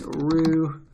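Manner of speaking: careful, drawn-out attempt at a French accent; best go at a French accent, word by word. Shown as a demonstration. Tutor slowly reading out a Paris address, attempted French accent. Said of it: "That's my best French accent".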